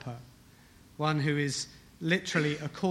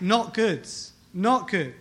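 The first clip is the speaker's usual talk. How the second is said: "Not good" punched higher and louder; emphatic; 3 semitones higher, 4 dB louder, slower than usual. Shown as a demonstration. Preacher showing congregation the Hebrew emphasis, emphatic. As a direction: stress "not good" hard, as a weighty verdict. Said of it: "it's emphatic".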